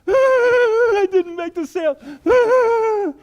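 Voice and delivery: a very loud high yell of frustration; frustrated yell. Pretend. Salesman venting at a customer, loud high frustrated yell. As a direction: scream out the lost sale. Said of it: "can't stand in front of a customer".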